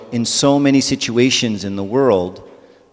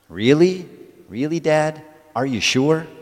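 higher, rising disbelief; doubting child; 6 semitones higher than usual. Acted. Coptic kid asks Dad if classmates miss heaven, incredulous.

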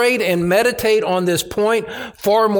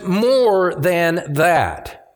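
very slow, stretched "More than that"; emphatic; much slower than usual. Retold or imitated. Preacher stressing Paul's further assertion to the congregation, emphatic. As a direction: give each word of "More than that" its own deliberate weight. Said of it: "profoundly emphatic in the Greek text".

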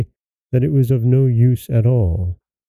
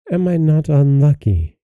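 higher-pitched, mild despair; despairing cry; 4 semitones higher than usual. A narrator's reading. Princess bewails her bad luck, mildly despairing. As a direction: exclaim at ill luck, understated. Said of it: "cried the poor princess".

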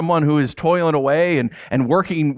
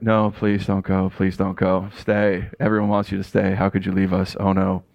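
mock pleading to the departing boss, lower, half-joking; 8 semitones lower than usual. Pastor fakes begging his bad boss to stay, mock pleading. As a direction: fake a begging "don't go" you don't mean. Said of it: "I was really going like".

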